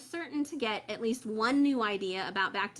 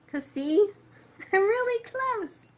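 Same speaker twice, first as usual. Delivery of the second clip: high, bursting laugh through the words; 12 semitones higher, 5 dB louder than usual. Real, not acted. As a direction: giggle at being so close. Too close to the camera.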